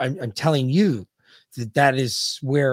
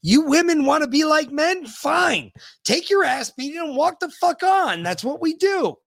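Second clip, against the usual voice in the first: mock macho rant; 12 semitones higher, 3 dB louder than usual.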